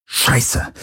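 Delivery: unvoiced hiss under the breath; hissed curse. A narrator's reading. Galen curses at the boarding threat, hissing. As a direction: hiss it out in dismay. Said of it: "zischte Galen".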